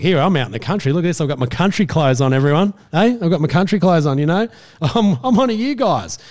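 hammed-up fake country enthusiasm; city reporter playing country. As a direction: show off to camera, desperate to fit in. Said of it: "an inner city journo reporter".

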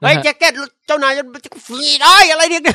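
high, loud, excited droid outburst. Acted. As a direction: squeal an accusation like an agitated little robot. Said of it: "ให้หุ่น บีบีเอสไปช็อตคุณฟินเล่นแบบ". (เสียงหุ่นยนต์ตัวเล็กโวยวายแหลมสูง)

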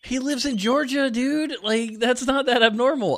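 excited. Real, not acted. Defending her goat belief.

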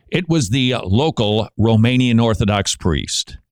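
ordinary talk

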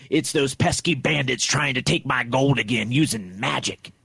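a hint of old Texas prospector. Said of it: "why is he gotta sound like he's from Texas".

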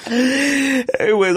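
slow, high, drawn-out "Anyways". Real, not acted. Trying to move past the slip, drawn-out and high. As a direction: stretch it out to change the subject.

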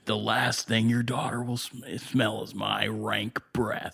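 dry, over-enunciated threat; John Malkovich. Acted. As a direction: linger on every word, slowing towards the end. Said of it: "he's very enunciating all of his intimidations".

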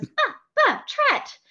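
put-on "Tret" squeaked very high like a tiny girl; high little-girl "Buh, buh, Tret". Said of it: "And she goes".

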